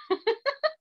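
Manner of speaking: short high-pitched laugh; quick bubbling giggle; high, light chuckle. Real, not acted. Teacher laughs after pupil's remark.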